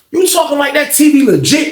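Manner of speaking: high-pitched petty challenge; super petty. Retold or imitated. Teller challenges Fonz over the T V, re-enacted pettily. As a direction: needle him with a high incredulous question. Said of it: "I got super petty with his ass".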